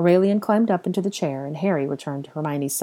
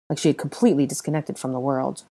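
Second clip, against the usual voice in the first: usual speaking voice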